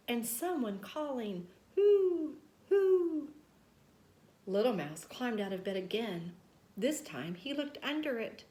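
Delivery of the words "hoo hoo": high, loud owl hooting; spooky owl call in the night. A narrator's reading. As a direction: hoot like a ghostly owl. Someone calling hoo.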